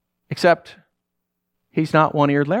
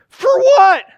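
high, loud frightened yell; screamed back at the ghost; panicked; 6 dB louder than usual. Acted. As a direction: shriek the question in terror.

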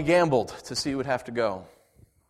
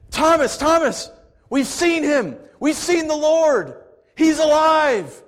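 high, loud, slow joyful cry; overjoyed Peter; 14 semitones higher, 9 dB louder, slower than usual. Acted. Peter to Thomas, bursting with joy, high and loud. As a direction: burst out with the news, beaming.

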